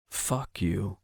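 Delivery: soft, muttered curse; said softly. Acted. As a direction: mutter it so he barely hears. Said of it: "I'd said the words softly".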